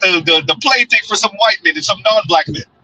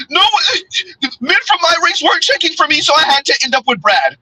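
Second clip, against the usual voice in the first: high, whiny mock excuse; mocking whiny woman; 7 semitones higher than usual. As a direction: mimic her excuse in a high voice. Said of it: "it's always".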